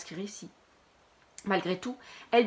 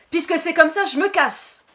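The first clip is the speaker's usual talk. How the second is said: loud, higher defiant outburst; angry exit; 8 semitones higher, 9 dB louder than usual. Acted. Anton walking out of class, loud defiant shout. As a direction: storm out, shouting your goodbye.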